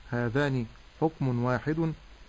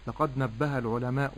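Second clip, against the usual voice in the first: ordinary talk, nothing marked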